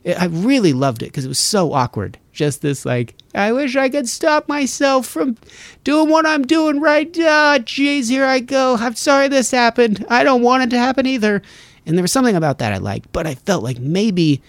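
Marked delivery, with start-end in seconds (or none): 3.3-11.4 much higher cat, awkwardly apologising; sheepish cat voice. Retold or imitated. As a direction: voice a cat sorry for itself, high.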